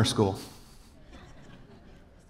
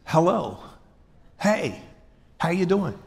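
bright, higher, friendly hello; friendly greeting demo; 5 semitones higher than usual. Shown as a demonstration. Pastor shows church how to greet, friendly and higher. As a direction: greet someone in passing with a lift. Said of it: "Greet is just".

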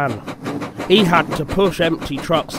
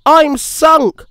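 high, loud wail; dismayed cry; 12 semitones higher, 7 dB louder than usual. A narrator's reading. Thomas sinks into the hole, high dismayed cry. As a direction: wail it as all is lost.

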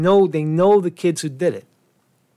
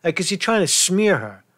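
ordinary delivery

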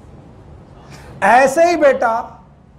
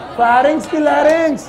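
raised, higher-pitched teacher's question; insistent quizzing; 4 semitones higher than usual. Real, not acted. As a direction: demand the answer from the whole class. Asks again after scolding the class.

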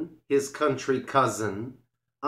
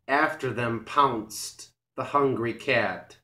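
usual speaking voice

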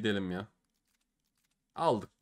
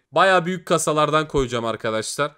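ordinary talk

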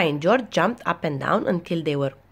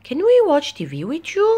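high, loud little-pig question; child's eager request; 10 semitones higher, 6 dB louder than usual. A narrator's reading. Peppa asks Granny for TV, high childlike request. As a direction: ask like a hopeful youngster, pitched up.

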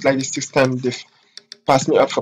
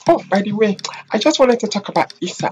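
higher gossipy coworker voice; imitating coworker; 6 semitones higher than usual. Coworker gossiping about Issa, mock gossipy and higher. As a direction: dish the gossip, pitched up. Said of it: "coworker gossiping, retold".

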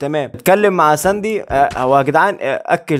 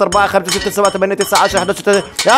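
ordinary talk

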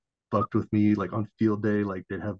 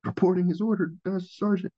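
higher, crisp military sound-off; dutiful private reporting; 10 semitones higher than usual. Retold or imitated. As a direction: snap to attention and report in. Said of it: "the good P F C that I am".

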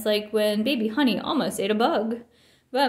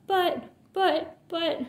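higher, stumbling sputter; 7 semitones higher, slower than usual. A narrator's reading. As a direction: trip over the buts, higher. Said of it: "sputtered Brother".

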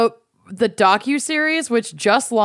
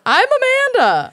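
excited. Real, not acted. Hosts introduce themselves playfully.

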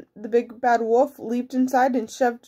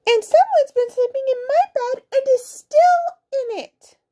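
very high, squeaky little bear; squealing little bear; 4 dB louder than usual. A narrator's reading. Little bear finds sleeper in bed, high squeal.

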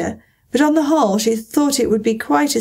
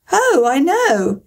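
slow, high eager exclamation; exclaiming; 6 semitones higher, much slower than usual. A narrator's reading. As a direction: blurt it out eagerly. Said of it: "exclaimed Alice".

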